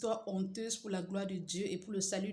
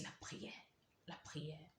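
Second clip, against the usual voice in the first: whisper; 12 dB quieter, slower than usual. Retold or imitated. Whispered prayer remembered.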